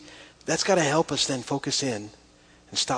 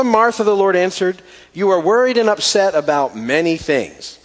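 loud, solemn Bible reading; scripture reading; 6 dB louder than usual. Acted. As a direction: read it out like Scripture. Reads Luke ten aloud.

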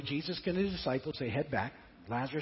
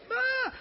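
startled yelp; 4 dB louder than usual. Retold or imitated. Startled at mummy coming out.

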